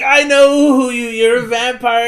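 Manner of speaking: loud, high, hammed-up rage; acted angry accusation. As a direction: accuse your friend in a big theatrical yell. Acts Steve accusing Darren.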